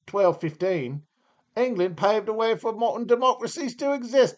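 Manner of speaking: posh-sounding British accent, mocking. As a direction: play the pompous Brit reading his own country. Mock British reading of UK entry.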